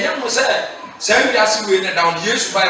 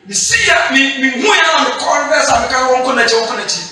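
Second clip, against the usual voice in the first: fervent preaching; 5 semitones higher than usual. Acted. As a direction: lift the congregation with a shout. Preaching at full force.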